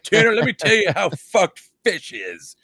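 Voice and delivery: high, loud gloating with a laugh; gleeful ripping. Retold or imitated. Mocking Tanner's favourite band, loud gleeful gloat. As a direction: crow over a rival's bad song. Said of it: "being like".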